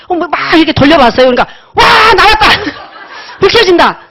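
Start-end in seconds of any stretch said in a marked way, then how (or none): 1.7-4.0 triumphant shout. Retold or imitated. The lights finally come on in the dark car, laughing triumphant shout. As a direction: cheer like you just found the light switch. Finally finds the headlight switch.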